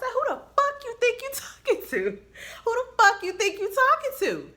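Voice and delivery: screaming it, pitch way up and loud; furious shout. Retold or imitated. Nurse yelling at a disrespectful CNA, furious shout. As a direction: yell it like you just snapped.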